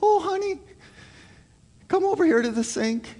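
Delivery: high, cooing mother's voice; tender mom. Retold or imitated. Mom calling her hurt boy to the sink, high and cooing. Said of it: "And you know what Mom wants to do?".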